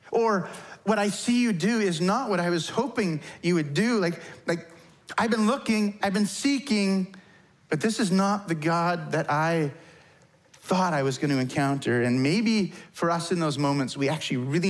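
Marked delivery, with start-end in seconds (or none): 0.1-12.0 higher-pitched complaint, settling toward the end; mildly frustrated confession; disappointed prayer. Acted. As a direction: voice a seeker's letdown to God. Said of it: "in the form of a prayer".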